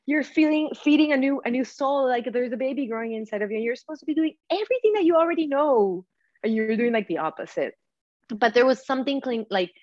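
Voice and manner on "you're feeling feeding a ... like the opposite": high, chiding inner-conscience voice; the inner voice scolding her for craving junk. Retold or imitated. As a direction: play her spiritual heart scolding her. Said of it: "my spiritual heart was like".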